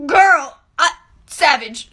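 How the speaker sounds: excited shriek. Real, not acted. Storyteller reacting to the tampon comeback, loud excited cry.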